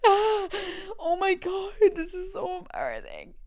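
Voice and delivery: high, drawn-out mock mortified wail; embarrassed teenager. Teenager hearing mother's story, high mortified wail. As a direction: whine it like a dying-of-shame teen. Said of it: "when you're a teenager, you're like".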